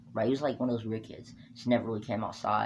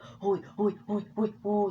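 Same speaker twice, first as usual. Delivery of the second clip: put-on high, whimpering hoi sounds like a hurt kid; mock crying; 10 semitones higher, slower than usual. Narrator imitating the bully crying after his hands got twisted. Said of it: "He started crying".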